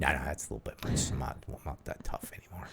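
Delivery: whispered self-mockery; whispering. Real, not acted. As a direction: whisper it to yourself. Self-deprecating aside.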